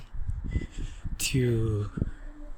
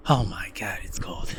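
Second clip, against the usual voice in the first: cold whisper under breath; 4 semitones lower, 4 dB quieter than usual. Real, not acted. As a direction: breathe it out shivering.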